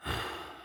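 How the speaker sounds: long, weary exhale; agonized sigh. A narrator's reading. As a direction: let out a pained sigh. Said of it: "let out a long, agonizing sigh".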